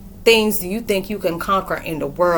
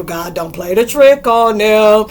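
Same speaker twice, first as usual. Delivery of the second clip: higher, louder, slow preacher proclamation; louder, slowed rebuke of the demons; exorcist believer; 3 semitones higher, 9 dB louder, slower than usual. Retold or imitated. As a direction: declare it like a fired-up exorcist. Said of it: "made me feel like I was an exorcist believer".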